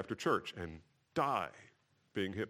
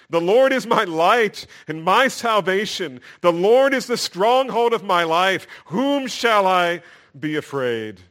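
much higher and louder psalm reading; fervent psalm proclaimed to the congregation; preaching at full force; 10 semitones higher, 12 dB louder than usual. Acted. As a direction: thunder the psalm out with conviction.

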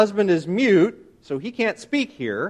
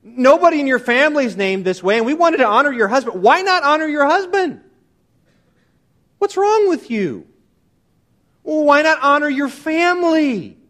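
high, raised, exasperated pleading; indignant protest; 8 semitones higher, 5 dB louder than usual. Retold or imitated. Relatives confront mother over the name, high and indignant. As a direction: protest as affronted relatives, pitch climbing.

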